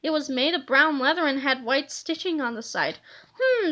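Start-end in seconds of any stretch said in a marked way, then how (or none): 3.4-3.7 high, musing hum; a curious hero's hmm; pondering hum, pitched up; thoughtful hmm. A narrator's reading. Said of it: "said Captain Underpants".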